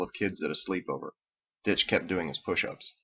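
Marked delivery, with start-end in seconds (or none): none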